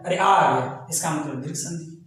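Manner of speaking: a low, light "oh, there it is"; a hint of surprise; mock discovery. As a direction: act out spotting the vowel. Teacher acts student spotting आ.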